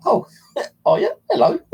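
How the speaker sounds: imitating the woman on the phone. Mimicking the amused woman on phone.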